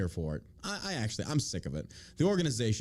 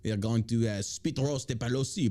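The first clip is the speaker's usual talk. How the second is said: hammed-up French revolutionary; rally them in a cartoonish French accent; mock French accent; 3 semitones lower than usual. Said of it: "why my Nazis are French".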